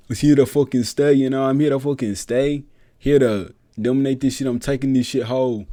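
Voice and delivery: mock brash fighter promo; hyped fighter on the mic. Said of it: "Came out".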